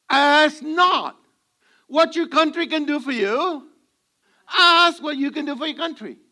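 Kennedy impression. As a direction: deliver it like a president's famous address. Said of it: "That was a Democrat called Kennedy".

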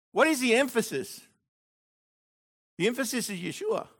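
ordinary talk

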